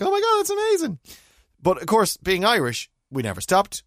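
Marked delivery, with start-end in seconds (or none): 0.0-1.0 very high-pitched gushing fan; mock squeal of amazement; retold excitement. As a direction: play up the avid fan's delight. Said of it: "we, of course, were avid viewers".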